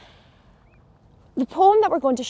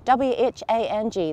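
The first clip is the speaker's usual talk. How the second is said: usual speaking voice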